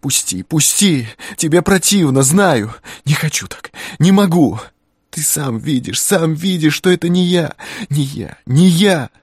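high, loud sobbing shouts; angry crying. A narrator's reading. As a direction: scream through dry sobs. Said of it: "рыдая без слёз, кричала".

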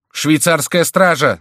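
high, loud, drawn-out warning shout; shouted alarm. A narrator's reading. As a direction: shout the alarm across the room. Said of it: "закричал Фулон". (тревожный крик)

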